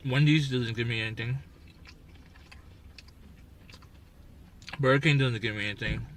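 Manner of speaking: talking through a mouthful of food; eating while talking; mouth full. Real, not acted. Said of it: "he has his mouth full".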